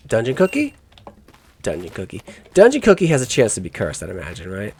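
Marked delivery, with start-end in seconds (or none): 0.1-0.7 high, rising "Dungeon cookie?" as if baffled; puzzled question. Real, not acted. Reads odd item name aloud. 1.6-2.2 softer, lower "Dungeon cookie." muttered to oneself; quiet repeat. Real, not acted. Repeats item name to himself.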